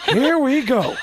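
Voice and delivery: a Pacino groan breaking into a laugh; Al Pacino impression. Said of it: "and Al Pacino".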